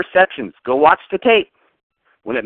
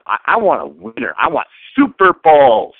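ordinary talk, nothing marked

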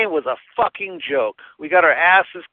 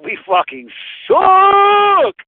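frustrated shout; 13 semitones higher, much slower than usual. Real, not acted. Fan on the phone to the host, shouting in frustration. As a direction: let it rip, dragging the words out. Caller venting about his team.

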